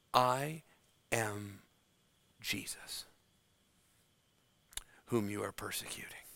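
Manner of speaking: soft whispered reveal of the name, almost silent on Jesus; a whispered divine revelation; hushed whisper. Acted. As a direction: breathe I am Jesus in an awed whisper.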